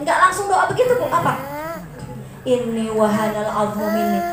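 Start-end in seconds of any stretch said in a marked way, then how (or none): 2.5-4.2 tearful prayer. Acted. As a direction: recite the verse with a crying catch. Tearful prayer recited as model.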